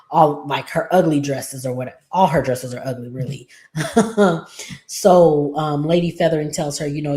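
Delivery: usual speaking voice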